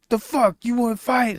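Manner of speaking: high, aggressive mock challenge; high mock tough-guy challenge. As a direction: imitate a street tough picking a fight. Acting out hostile Newark strangers.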